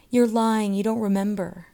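put-on children's cry. A narrator's reading. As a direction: cry out the accusation like jeering children. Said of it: "cried the children".

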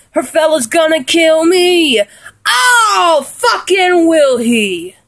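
high, drawn-out mock-British outburst; hammed-up lyric quote, pitched high; acted song line. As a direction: belt out the quoted line, stretching the words. Quoting a favorite song line with gusto.

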